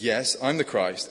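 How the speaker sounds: measured, emphatic announcement of who he is; solemn declaration. Acted. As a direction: proclaim it slowly, every word landing. Preacher voices Jesus declaring identity.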